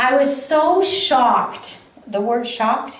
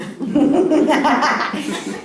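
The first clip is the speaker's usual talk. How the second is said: laughing; 3 semitones higher than usual. Real, not acted. Laughing at her mantra.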